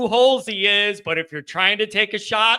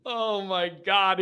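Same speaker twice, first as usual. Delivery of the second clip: ordinary talk, nothing marked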